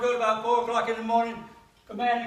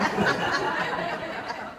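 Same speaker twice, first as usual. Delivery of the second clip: breathy laugh; 3 semitones higher than usual. Real, not acted. Laughs at own joke.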